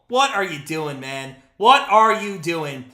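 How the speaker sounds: usual speaking voice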